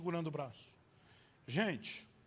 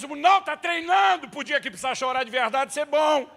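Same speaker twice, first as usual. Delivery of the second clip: higher, loud sarcastic shout; shouting to God; 7 semitones higher, 10 dB louder than usual. Retold or imitated. Father wanting to scream sarcastically, loud. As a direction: yell the sarcasm. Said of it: "Eu quero gritar pra Deus".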